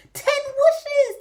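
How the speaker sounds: high loud shout after him; piglets crying out. Acted. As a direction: call out after him eagerly. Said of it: "they cried after him".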